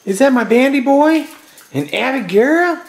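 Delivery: high, sing-song pet voice. Real, not acted. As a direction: coo at the dogs like they're babies.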